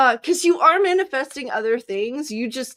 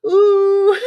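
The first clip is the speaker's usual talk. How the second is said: mock little sister ooh; 10 semitones higher, 10 dB louder than usual. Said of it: "and you're like".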